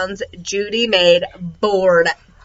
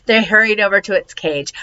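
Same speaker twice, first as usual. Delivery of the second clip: ordinary delivery, nothing marked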